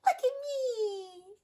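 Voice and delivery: high, drawn-out excited call; duckling crying out. A narrator's reading. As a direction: show off with a long, high cry. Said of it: "she cried".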